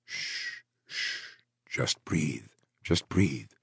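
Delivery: hushed, gentle whisper; calm her breathing softly; soothing whisper. A narrator's reading. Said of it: "Crow soothed".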